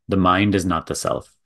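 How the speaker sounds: measured guiding delivery with long silences between phrases; contemplative spiritual teaching. Real, not acted. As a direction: let each phrase land, then leave a long pause. Leads a contemplative talk.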